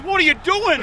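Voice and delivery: loud, high mock confrontation; retold outraged shout. Owner confronting the stranger outside his house, imitated outraged shout. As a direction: demand it like an outraged farmer.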